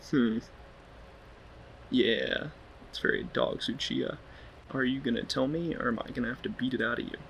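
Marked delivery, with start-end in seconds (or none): none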